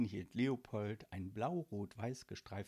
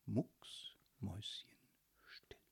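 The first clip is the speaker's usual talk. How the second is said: hushed whisper; 3 semitones lower, 7 dB quieter, much slower than usual. A narrator's reading. Narrator hushes to silence.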